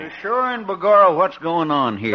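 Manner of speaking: put-on Irish accent. Irish cop speaks.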